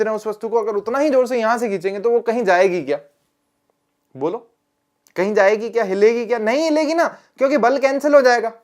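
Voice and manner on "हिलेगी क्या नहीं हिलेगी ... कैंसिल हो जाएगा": ordinary talk, nothing marked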